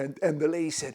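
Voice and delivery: ordinary delivery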